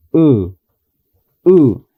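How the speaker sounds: "U" with lips barely parted, short; short English U. Shown as a demonstration. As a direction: say "U" relaxed, lips apart. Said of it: "con esta vocal que se pronuncia".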